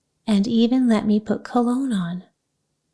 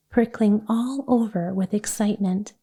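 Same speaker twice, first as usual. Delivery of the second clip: plain reading voice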